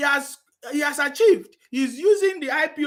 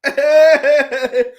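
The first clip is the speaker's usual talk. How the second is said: louder laugh mixed with fake crying; mock sobbing laugh; 3 semitones higher, 10 dB louder than usual. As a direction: laugh as if wailing.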